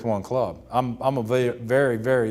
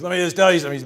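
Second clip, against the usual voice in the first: louder, higher big-man swagger; boastful; 7 semitones higher, 6 dB louder, much faster than usual. Retold or imitated. Ted Williams boasting before his card-playing buddies, boastful. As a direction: show off for your buddies. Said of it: "He's gonna boast a little bit in front of all of his buddies".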